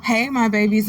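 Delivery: usual speaking voice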